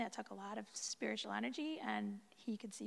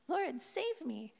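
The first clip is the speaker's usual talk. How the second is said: pleading cry; 5 semitones higher, 4 dB louder, slower than usual. A narrator's reading. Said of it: "cried out".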